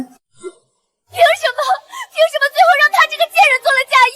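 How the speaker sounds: very high, crying wail of protest; tearful outburst. Acted. Daughter protests sister's royal match to mother, tearful outburst. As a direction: wail it through angry tears. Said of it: "芬儿，别哭了".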